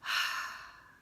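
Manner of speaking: loud unvoiced sigh of air; a pure airy exhale; demonstrated breathy sigh. Shown as a demonstration.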